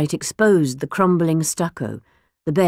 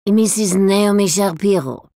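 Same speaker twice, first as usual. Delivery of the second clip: put-on grand, foreign-accented old lady, indignant; dotty old lady full of disdain; eccentric old woman; 2 semitones higher, 5 dB louder than usual. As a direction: scoff at the barbarians who bin good records. Old woman voiced in the story.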